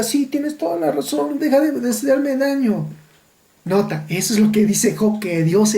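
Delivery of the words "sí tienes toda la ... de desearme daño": yielding believer. Retold or imitated. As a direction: give in meekly, as if bowed down. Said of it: "llegue inclinado y diga". (creyente rendido, voz aguda y sumisa)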